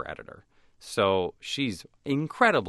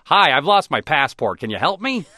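high, loud, naive tourist voice; goofy galoot pleading at the consulate; clueless American kid; 9 semitones higher, 13 dB louder than usual. Retold or imitated. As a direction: play the big dumb American asking for help. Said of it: "galoot of an American kid going like".

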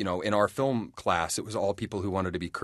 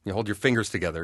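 normal talking voice

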